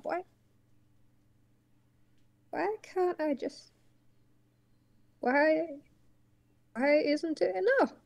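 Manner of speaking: put-on high, strained old tortoise pleading; an old uncle begging to keep them safe; anxious old tortoise. Old tortoise pleading with his nephew, high and strained.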